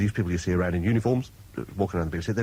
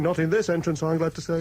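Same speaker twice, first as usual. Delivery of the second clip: lightly voiced toff; 5 semitones higher than usual. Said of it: "kind of thing".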